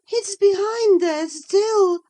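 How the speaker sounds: frightened insistence that something is hiding; anxious. A narrator's reading. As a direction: Catherine afraid of the covered mirror, anxiously. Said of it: "she pursued anxiously".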